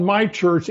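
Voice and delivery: "my" pressed, church falling after it; preacher stressing "my" in "my church". Shown as a demonstration. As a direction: lean hard on "my" in "my church". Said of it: "But the emphasis on".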